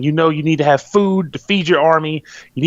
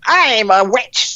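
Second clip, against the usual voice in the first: slow, higher gruff storybook witch; dwarf-voiced witch; 6 semitones higher, much slower than usual. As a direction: drawl it like a dwarf narrator playing a witch. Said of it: "a male that sounds like a dwarf".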